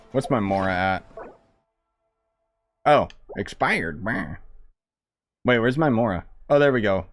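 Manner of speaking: normal talking voice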